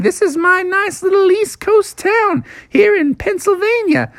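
high-pitched, chatty cartoon groundhog; Punxsutawney Phil. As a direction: show off your town as a tiny celebrity. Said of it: "Phil was like".

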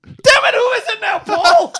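high, loud demand for an answer; exasperated shout. Real, not acted. Pressing co-host for a withheld name, exasperated shout. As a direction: burst out, pitch way up.

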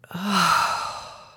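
long breathy ah release; audible ah sigh. Shown as a demonstration. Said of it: "and then sighing it out".

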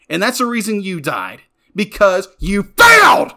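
high, loud and heated, ending in a shout; climbing fury that ends in a shout; real angry outburst. Real, not acted. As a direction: lose your temper at the character. Emotional rant about a selfish brother.

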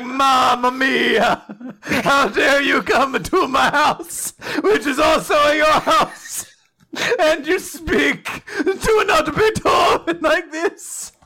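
outraged cartoon Italian, high and slow; big operatic Italian outrage; mock Italian accent. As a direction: wail indignantly in hammed-up Italian. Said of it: "but he's Italian".

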